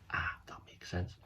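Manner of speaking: soft whisper to the listener; whispered aside. Real, not acted. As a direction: let them in on it quietly, in a whisper. Narrator's aside to young listeners.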